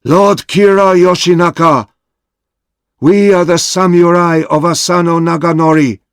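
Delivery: high, loud, solemn proclamation; formal declaration. A narrator's reading. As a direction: announce their loyalty with pride. Oishi formally addresses Kira.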